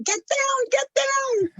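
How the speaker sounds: high, urgent mock yell, volume held back; imitated yelling. Runner recalling a warning to the group under crackling power lines, mock yell. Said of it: "we were yelling".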